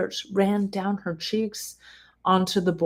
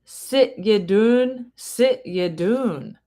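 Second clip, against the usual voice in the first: slow, drawn-out Scottish "Sit ye doon"; lightly voiced Scottish spirit speaking through the board; Scottish accent; 4 semitones higher, much slower than usual. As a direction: give it a little Scotsman's Scottish lilt, slowly. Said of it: "hence the accent".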